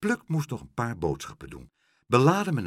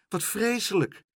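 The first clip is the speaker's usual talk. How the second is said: high-pitched, dismayed exclamation; appalled exclamation; 11 semitones higher than usual. A narrator's reading. Boy finding a drowned horse, appalled. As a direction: exclaim it high, in mild horror.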